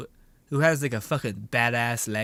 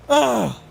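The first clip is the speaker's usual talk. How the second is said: loud, higher groan of exasperation; exasperated groan; 6 semitones higher, 7 dB louder than usual. Real, not acted. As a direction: groan loudly in frustration at the game. Exasperated at the game.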